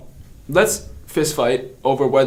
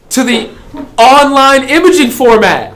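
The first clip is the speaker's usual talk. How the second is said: incredulous outburst; 9 semitones higher, 6 dB louder than usual. Real, not acted.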